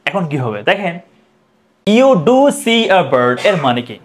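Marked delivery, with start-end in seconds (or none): 2.0-3.4 "do" punched, said slowly; stress on do. Shown as a demonstration. Teacher drilling students, slow emphatic "do". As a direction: lean hard on "do" to insist. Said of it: "মানে জোর দিয়ে বলছি".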